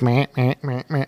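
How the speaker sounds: soft, repeated buck grunts; imitated deer grunts. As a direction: mimic a buck grunting on the chase. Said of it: "he must have grunted".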